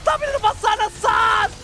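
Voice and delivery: loud, high play-by-play yell; excited shouting. Real, not acted. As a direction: shout the team fight call. Hype team fight play-by-play. (teriakan komentator yang heboh)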